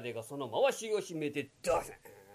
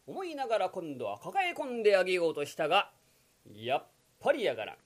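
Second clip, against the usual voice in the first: ordinary talk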